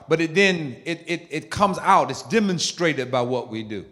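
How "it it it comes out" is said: usual speaking voice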